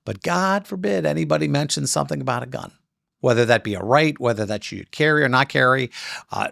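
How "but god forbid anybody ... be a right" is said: ordinary delivery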